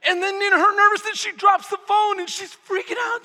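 loud, high, urgent narration; dramatic storytelling. Acted. Preacher tells congregation of the trucker chase, dramatic storytelling. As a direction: drive the chase story with rising panic.

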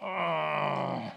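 low, soft groan of discomfort; annoyed grunt. Retold or imitated. Husband reacting to a clingy wife with a groan. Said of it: "he's like".

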